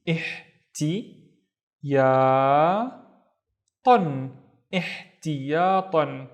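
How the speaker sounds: "ihtiyatan" drawn out very slowly, syllable by syllable; slow, careful "ihtiyatan"; slow blend. Shown as a demonstration. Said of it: "slowed word demo".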